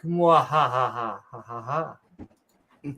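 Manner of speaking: evil villain cackle, low; mad scientist. Acted. Evil laugh after spooky question.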